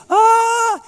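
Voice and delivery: sudden loud high wail of a sob; crying wail. Retold or imitated. Pastor reenacts breaking down at the grave, loud high wailing cry. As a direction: let out a high, loud cry like the tears burst.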